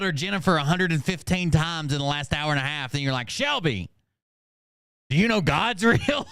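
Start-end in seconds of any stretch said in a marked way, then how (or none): 3.3-6.3 high laughing mock of the question; mock preachy storyteller. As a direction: ask it like a tent preacher.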